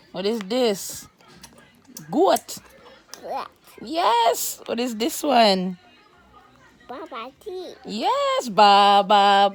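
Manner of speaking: high, loud, sing-song teacher voice; talking to a toddler. Acted. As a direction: coax a small child through a picture book.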